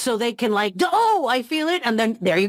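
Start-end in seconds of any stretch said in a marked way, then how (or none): 0.8-1.8 high-pitched acted jolt; mock sudden feeling. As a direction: exclaim as if emotion just hit. Mimics actor feeling provoked emotion.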